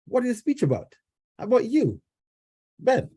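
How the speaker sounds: put-on high, light little boy's voice; child asking dad; young son's voice. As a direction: speak up high like a small child.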